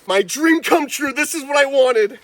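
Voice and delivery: jubilant shout. Real, not acted. His dream of a wild king cobra come true, jubilant. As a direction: savor the dream then let out a yell.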